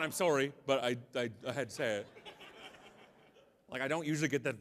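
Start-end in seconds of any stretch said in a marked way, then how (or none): none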